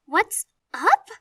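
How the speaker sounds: high, loud tearful screech. Acted. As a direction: screech it back at him in disbelief. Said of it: "she screeches".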